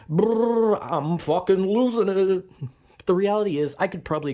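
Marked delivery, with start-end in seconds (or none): 0.1-2.5 hammed-up growl, then slow; melodramatic outburst. Showing off his melodrama, a hammed-up meltdown. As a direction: ham up losing your mind. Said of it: "sometimes I like to just be like".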